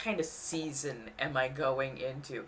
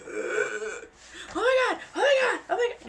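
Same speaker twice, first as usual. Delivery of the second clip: mock panic. East Coaster in an earthquake, hammed-up frantic panic. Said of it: "They've panicked so hard".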